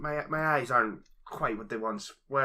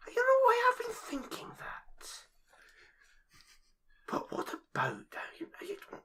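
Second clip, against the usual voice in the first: whisper. Acted. Shy musing in hushed voice.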